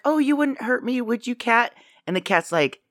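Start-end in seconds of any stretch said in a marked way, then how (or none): 0.0-1.7 high, coaxing mock plea; put-on timid voice, pitched up; pleading Suzanne. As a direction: sweet-talk the cat. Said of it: "'cause she's like".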